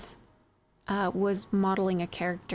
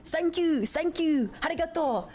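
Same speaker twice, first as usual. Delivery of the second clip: put-on Japanese accent; 8 semitones higher than usual. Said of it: "And he was like".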